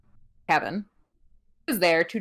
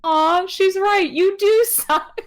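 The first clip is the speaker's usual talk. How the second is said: mock sweet agreement; 12 semitones higher, 6 dB louder, much slower than usual. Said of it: "and he's like".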